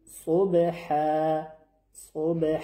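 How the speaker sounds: صبح ending on a clear breathy ح; صبح, final h fully voiced out; clear pausal h. Shown as a demonstration. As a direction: let the ح of صبح ring clear at the stop. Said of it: "حرف ح شفاف".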